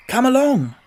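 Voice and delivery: bright open invitation to join; hearty. A narrator's reading. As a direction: Scarecrow heartily welcoming a new friend. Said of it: "said the Scarecrow heartily".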